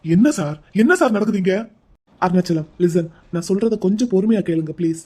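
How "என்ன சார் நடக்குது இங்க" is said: ordinary talk, nothing marked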